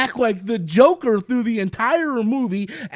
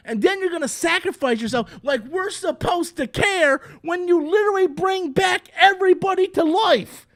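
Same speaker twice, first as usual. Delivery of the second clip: ordinary delivery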